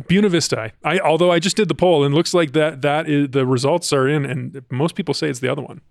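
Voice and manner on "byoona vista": Buena said BYOO-nuh; local pronunciation. Shown as a demonstration. Co-host insisting on own choice, Buena as BYOO-nuh. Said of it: "someone from Buena Vista will call in".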